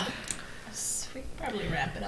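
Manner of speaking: whispering. Real, not acted. Whispered wrap-up aside.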